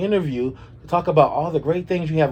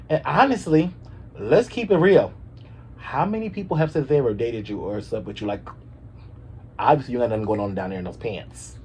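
usual speaking voice

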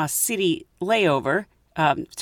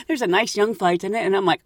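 ordinary delivery

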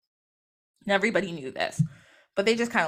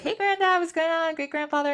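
high, singsong hello; casual fake-sweet greeting; 9 semitones higher than usual. Granddaughter greeting her resting great grandfather, high innocent voice.

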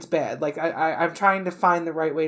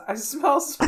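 laughing quote; 9 semitones higher than usual. Retold or imitated. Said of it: "someone says".

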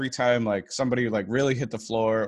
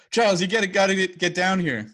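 panicky Xander voice; 8 semitones higher, 3 dB louder than usual. Retold or imitated. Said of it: "Xander is like".

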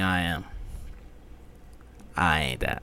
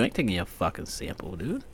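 normal talking voice

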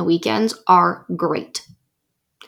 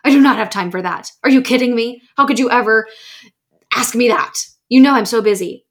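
mock outrage; 3 semitones higher, 4 dB louder than usual. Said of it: "you're like".

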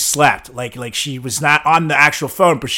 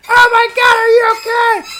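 much higher panicked shout, imitating; panicked girlfriend; 6 dB louder than usual. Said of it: "like, freaking out".

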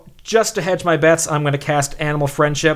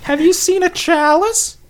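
very high, squeaky questioning. Acted. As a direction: ask it in a tiny high voice. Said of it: "Speaks in a deep voice".

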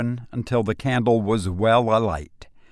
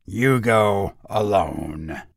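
put-on ominous old woman; 3 dB louder, slower than usual. A narrator's reading.

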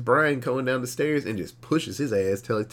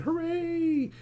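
mock cheer; 3 dB quieter than usual. Real, not acted. Sarcastic cheer.

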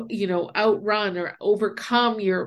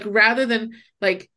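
usual speaking voice

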